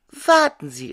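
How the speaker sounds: high, loud call to stop her; starling calling sharply. A narrator's reading. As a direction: shout after her to wait. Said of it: "rief der Star".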